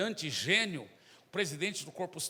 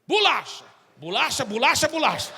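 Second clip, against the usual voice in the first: very loud, higher repeated word; loud repetition; 6 semitones higher, 12 dB louder than usual. Acted. Zé repeats the word to the stunned president, loud and insistent. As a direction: hammer the word over and over.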